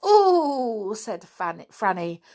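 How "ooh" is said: high, thrilled little gasp of joy; delighted ooh. A narrator's reading. Franny thrilled at the adventure, delighted ooh. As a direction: squeal it, shivering with delight. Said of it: "shivering with delight".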